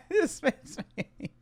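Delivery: words squeezed out through laughter; laughing. Real, not acted. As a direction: talk while still laughing, slowly. Laughing while reacting.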